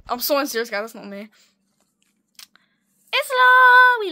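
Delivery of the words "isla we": high, loud squeal of the name; excited cry. Real, not acted. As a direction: call it out high and long in delight. Spots a favourite drawing.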